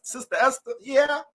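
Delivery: a hint of church-lady skit; acted church exchange. Acts pastor calling, Esther answering.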